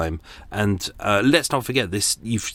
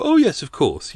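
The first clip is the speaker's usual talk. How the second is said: lightly acted, higher-pitched eager yes; hint of an over-willing clerk; mock-agreeable hotel staff; 8 semitones higher, 4 dB louder than usual. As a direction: play an obliging receptionist, sarcastically. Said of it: "And you go".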